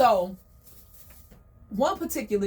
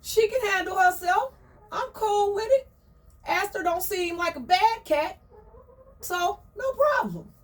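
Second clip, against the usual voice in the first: higher, chill hen voice; Crystal's easygoing voice; 9 semitones higher than usual. Retold or imitated. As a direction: play it cool and high. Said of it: "Crystal was like".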